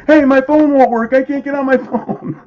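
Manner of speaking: high, loud, hammed-up complaint; mock panicked yell. Team waking to dead phones, hammed-up panicked yelling. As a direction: whoop it out in mock alarm.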